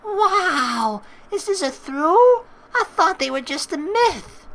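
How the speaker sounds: put-on high, loud, wide-eyed girl voice; Marina amazed. Marina calling out from inside the restroom, high amazed voice. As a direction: sound delighted and amazed.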